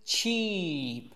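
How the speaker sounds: "cheap" with pursed ch and drawn-out ee; long vowel. Shown as a demonstration. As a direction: pucker for ch, hold the ee in "cheap". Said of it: "再加长一音". ("cheap"撅嘴ch加长音一)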